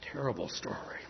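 hushed whisper. Real, not acted. Preacher whispering after the sad story. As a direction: whisper it sorrowfully.

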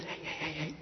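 urgent breathy whisper. Retold or imitated. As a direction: hiss it so the sleeping king won't wake. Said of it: "kind of whispers to him".

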